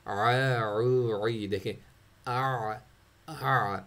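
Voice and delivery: عو and عی said slowly, the voice circling on without a stop; slow, unbroken ain demo; flowing ain. Shown as a demonstration. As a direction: let عو roll out from the middle throat. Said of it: "عین میں آواز stop نہیں ہوتی".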